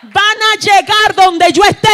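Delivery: high, loud shouted proclamation; John the Baptist declaring his followers will come; prophet's shout. Acted. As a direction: thunder it out as John. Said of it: "dijo Juan".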